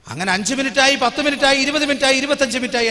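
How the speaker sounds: minutes counted up loud and high, growing anxious; rising anxious count. Retold or imitated. Preacher tells the church of waiting for his wife, loud and anxious. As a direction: call out each minute as the worry builds.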